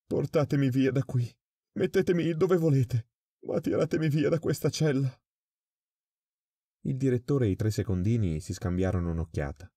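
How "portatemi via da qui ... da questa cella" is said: sudden terror. A narrator's reading. Prisoner begging the guards to move him, sudden terror. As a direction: burst out in terror. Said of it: "in un improvviso impeto di terrore".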